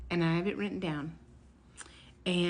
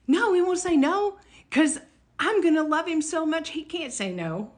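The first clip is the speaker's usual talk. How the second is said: excited; 8 semitones higher, 6 dB louder than usual. Real, not acted. Defiant hope against warning.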